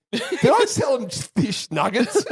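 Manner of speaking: slow, high-pitched, through laughter; laughing disbelief. Retold or imitated. Recalling the drunken night to mates, laughing disbelief. Morning-after question retold amid laughter.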